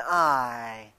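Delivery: "I" drawn out, low; a long, low "I" for emphasis; emphasized word. Shown as a demonstration. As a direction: set "I" apart and let it sink. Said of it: "notice the man's emphasis on the words".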